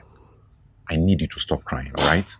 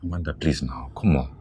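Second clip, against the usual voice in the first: ordinary talk, nothing marked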